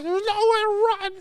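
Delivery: put-on very high, small kid voice for a giant; little kid voice. Bounty hunter threatening Ramsey in a little kid voice. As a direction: squeak a threat like a tiny kid. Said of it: "voiced over the Undertaker with a little kid".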